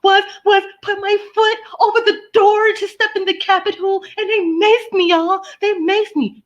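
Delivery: high, whiny, hammed-up fake-tearful lady; mock crying protester. Mocking the maced Capitol rioter, high whiny fake crying. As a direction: whimper it like a self-pitying victim. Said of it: "crying them little tears".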